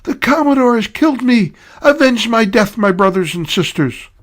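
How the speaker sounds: theatrical dying scream; dying yell. Acted. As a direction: cry out to your comrades as you fall. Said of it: "and yelled".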